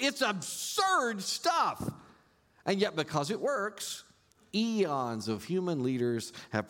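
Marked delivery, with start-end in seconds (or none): 0.0-1.9 slow scornful drawl. Real, not acted. Preacher repeating his verdict on politicians, slow and disdainful. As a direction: draw out "absurd" slowly with disdain.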